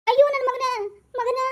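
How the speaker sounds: loud high cry of grief; anguished wail. Acted. Father crying out on finding his son.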